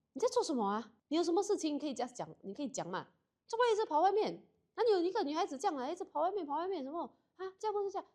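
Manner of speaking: shrill, high, loud mock scolding of a runaway granddaughter; scolding grandma. Grandma at the door scolding granddaughter for staying out, high loud scolding. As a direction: play the outraged grandmother, voice way up and loud.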